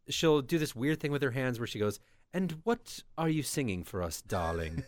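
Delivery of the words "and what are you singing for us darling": fake British accent. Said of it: "in a fake British accent".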